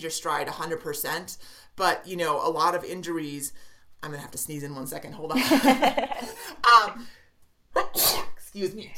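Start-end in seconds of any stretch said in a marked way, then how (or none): 5.4-6.7 laugh. Real, not acted. Laughing before a sneeze.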